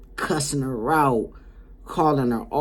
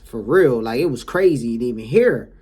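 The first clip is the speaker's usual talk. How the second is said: ordinary delivery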